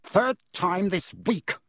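mock roar, slow and high; angry uncle roaring at breakfast. A narrator's reading. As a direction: bellow it across the table. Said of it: "he roared across the table".

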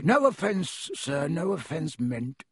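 ordinary delivery

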